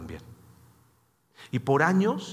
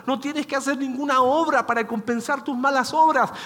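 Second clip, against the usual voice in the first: higher and louder, pleading the gospel; impassioned preaching; 9 semitones higher, 4 dB louder than usual. Retold or imitated. Pastor urging a weeping person to accept grace, impassioned preaching. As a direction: press every phrase home like a preacher. Retelling what he told the person.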